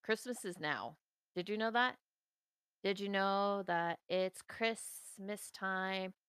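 ordinary talk, nothing marked

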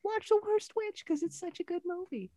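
high, thin hammy witch voice. Acted. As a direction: croon it like a campy witch. Mock witchy sign-off.

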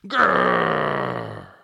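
long, very loud angry growl. A narrator's reading. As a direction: roar out a drawn-out grrr. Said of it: "shouted angrily".

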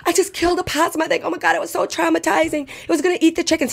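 high, shaken, out-of-breath telling; retold breathless upset. Telling her fiance she killed a possum, high and shaken. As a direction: still rattled, gasping it out. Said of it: "puff my inhale, I go".

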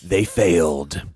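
epic announcer voice, barely holding it together; movie trailer voice. Pretend. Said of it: "are you gonna read it in his voice?".

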